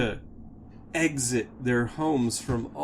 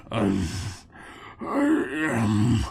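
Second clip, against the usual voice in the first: ancient dwarf; 4 dB louder, much slower than usual. Acted. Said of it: "decrepitly makes his way towards you".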